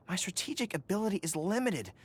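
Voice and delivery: ordinary delivery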